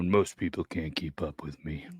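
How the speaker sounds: low, slow, hushed mimicry; mocking the bragger. Listener mimicking a stoner's brag, low slow mockery. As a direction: parody a boast, very quiet and drawn out.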